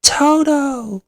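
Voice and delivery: very high, loud, long holler; belting out. A narrator's reading. Dorothy desperately calls her dog, belting out. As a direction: belt out a dog's name. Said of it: "belts out".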